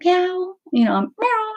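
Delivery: high meow calls; calling meow. Retold or imitated. Calling back to the cat with high meows. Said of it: "So I'm like".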